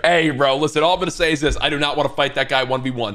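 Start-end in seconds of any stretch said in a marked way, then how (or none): none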